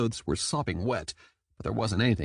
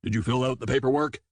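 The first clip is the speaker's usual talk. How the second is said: stern shark captain; faster than usual. A narrator's reading.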